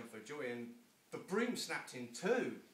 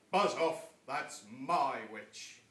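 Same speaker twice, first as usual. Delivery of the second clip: loud slow monster growl; loud, slow beastly warning; 11 dB louder, much slower than usual. Acted. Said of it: "a yowl and a growl".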